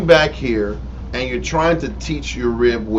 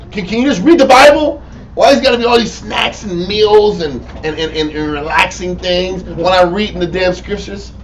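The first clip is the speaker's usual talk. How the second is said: vexed; 2 semitones higher, 3 dB louder than usual. Acted. Teacher fed up with snacking during Bible reading, vexed. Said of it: "that vexed the cuss out of there".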